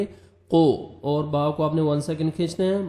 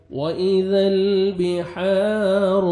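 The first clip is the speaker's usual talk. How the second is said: drawn-out melodic tajweed chant, higher and louder; Quran recitation; 3 semitones higher, 4 dB louder, much slower than usual. Shown as a demonstration. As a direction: chant the verse slowly like a qari.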